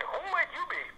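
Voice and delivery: imitating a kindly Irish lady; Irish brogue. Housemaster greeting a stranger in a high Irish brogue. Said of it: "said in her lovely Irish brogue".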